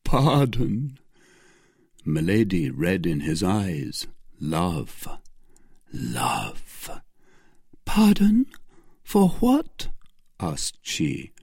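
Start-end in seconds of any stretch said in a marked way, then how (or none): 0.0-1.0 higher pleading cry; Felton's plea. Acted. Felton begging Milady for pardon. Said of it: "cried Felton". 7.9-9.9 high whispered question; whispered Milady. Acted. Milady asking Felton in a whisper.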